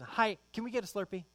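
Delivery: put-on high, fast begging kid voice; mock child asking for a treat; whiny kid begging. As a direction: rattle it off like an eager little boy. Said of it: "Like that".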